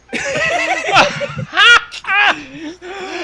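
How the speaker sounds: loud high laughing shout; loud laughter. Real, not acted. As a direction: howl with laughter.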